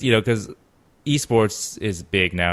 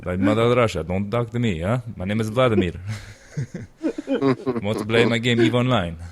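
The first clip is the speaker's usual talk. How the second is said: mock Russian accent, lightly done; hammed-up Russian villain, barely shifted; a half-hearted Russian accent; bad Russian accent; 3 semitones higher than usual. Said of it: "I apologize for my terrible accent".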